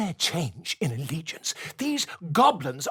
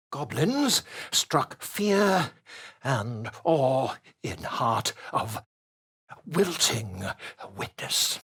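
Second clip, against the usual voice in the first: slow, measured dictation; dictating while writing; 5 semitones lower, slower than usual. Acted. Scribe reading aloud his chronicle, slowly. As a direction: drag out each word as the quill moves.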